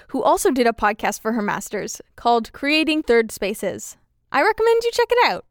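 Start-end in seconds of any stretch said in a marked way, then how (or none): 4.3-5.5 quick high aside. Real, not acted.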